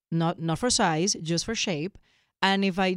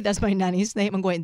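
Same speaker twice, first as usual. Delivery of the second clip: ordinary talk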